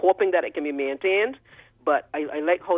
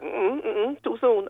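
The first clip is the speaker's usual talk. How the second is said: higher-pitched mock objection; playfully disapproving, pitched up; imitated refusal; 8 semitones higher, much slower than usual. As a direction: wave it off in an imitated, high-pitched voice. Acting out people's objection to criticism.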